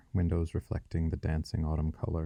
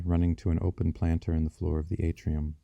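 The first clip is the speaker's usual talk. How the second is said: usual speaking voice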